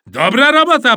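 loud, high congratulation; cheering praise. A narrator's reading. Boy praising teammate's capture, cheering. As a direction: cheer the catch on.

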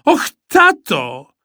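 put-on high, loud, slow childish wail.

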